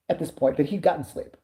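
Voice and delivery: usual speaking voice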